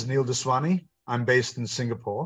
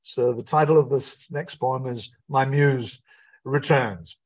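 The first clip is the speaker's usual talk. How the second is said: ordinary delivery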